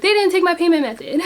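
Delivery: high, put-out mock protest; playful high-pitched complaint. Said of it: "and you be like".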